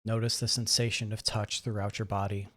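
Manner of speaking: calm, measured voice with long pauses; guided meditation. Acted. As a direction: gently guide listeners through breath and body, softening as they settle. Leads a guided breathing meditation.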